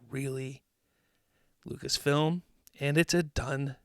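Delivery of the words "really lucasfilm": much softer, lower incredulous question; disbelieving fans. Retold or imitated. Fans looking at Lucasfilm in disbelief, soft incredulous question. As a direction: ask it quietly in flat disbelief. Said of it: "we go".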